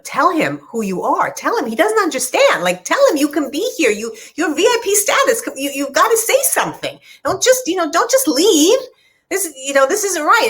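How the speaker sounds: put-on high-pitched, urgent pleading of a child, no real tears; retold upset pleading. Young daughter begging her mother to stand up to the man, high urgent plea. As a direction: beg her to speak up, voice pitched high and pressing. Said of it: "screaming and crying at her".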